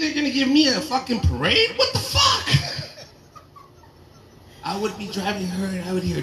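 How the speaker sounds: ordinary talk, nothing marked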